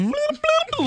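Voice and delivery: very high, squeaky bloop bloop; mocking how she sounded coming out of the bathroom; silly bloop noises. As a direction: make a goofy cartoon bubble sound. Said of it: "And you can go".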